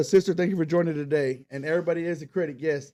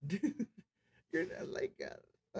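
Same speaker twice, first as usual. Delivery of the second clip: whispered, barely voiced aside; under your breath so nobody hears; hushed aside; 6 semitones higher, 11 dB quieter, much slower than usual. Young self muttering in stands.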